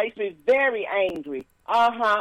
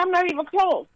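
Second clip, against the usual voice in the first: higher protest; indignant retort; 7 semitones higher than usual. Retold or imitated. Telling the car's AI she's not close to anyone. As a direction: push back, higher. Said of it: "I said".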